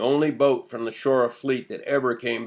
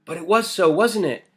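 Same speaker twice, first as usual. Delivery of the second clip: put-on higher-pitched urgent child's plea; 7 semitones higher, 4 dB louder than usual. A narrator's reading. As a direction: press the question eagerly, pitched up. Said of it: "Lena said urgently".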